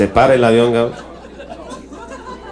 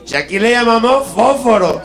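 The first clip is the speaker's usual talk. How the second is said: higher Castilian Spanish, llamamos stretched; Spaniard's correction; 10 semitones higher than usual. Acted. Spaniard correcting the Mexican about matches, Castilian. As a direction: smugly correct him like a Madrid local.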